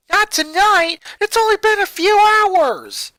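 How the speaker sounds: high, loud outraged protest; upset shout. A narrator's reading. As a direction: shout it in disbelief. Daughter protests in disbelief.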